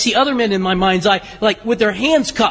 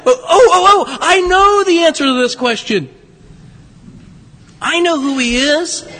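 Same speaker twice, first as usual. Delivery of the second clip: Horshack; 7 semitones higher than usual. Retold or imitated. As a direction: beg the teacher to call on you, voice up high. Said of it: "You're like Horshack".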